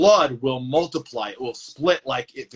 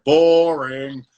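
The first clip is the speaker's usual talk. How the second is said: mock bored call; 6 dB louder than usual. Quoting viewers commenting.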